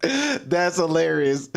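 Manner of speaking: ordinary talk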